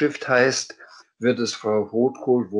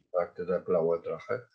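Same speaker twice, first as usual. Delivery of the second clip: plain reading voice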